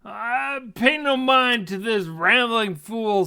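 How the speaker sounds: high, loud retort; angry dismissal. Acted. Dwarf dismissing the half-elf's accusation, loud and irritated. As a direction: wave the accuser off loudly.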